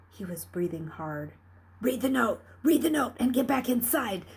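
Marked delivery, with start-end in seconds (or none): 1.8-4.2 urgent inner voice. Acted. Luke's inner urging voice.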